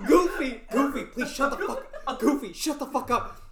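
high, loud, laughing cartoon rant; wild unhinged ranting. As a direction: rant like a crazed cartoon mouse.

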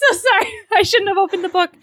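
high, loud, helpless laughter through the words; real laughing apology. Real, not acted. Cracking up after opening the book mid-discussion, laughing apology.

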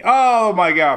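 loud, high groan of disbelief; exclaiming. Real, not acted. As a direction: react to a terrible pun. Groans at dad joke.

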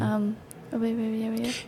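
very soft mock mumble; trailing-off mumble. Wife mimicking husband's sentence as it trails off, mumbled. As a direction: let the words sink away almost to nothing. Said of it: "Then it just trails off".

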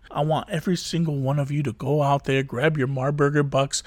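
ordinary delivery

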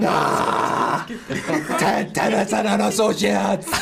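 slow, high war cry; battle cry. Acted. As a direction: bellow it like a berserker. Raging barbarian cry.

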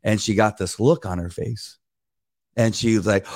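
usual speaking voice

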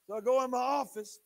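ordinary talk, nothing marked

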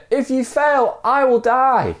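very high-pitched, loud plea; high acted cry from below; desperate appeal. A narrator's reading. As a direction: plead upward for your life. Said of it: "appealed the stranger".

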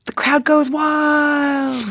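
slow, drawn-out hammed-up announcer shout; mock sports commentary. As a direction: ham up a stadium announcer welcome. Mock cheer as guest arrives.

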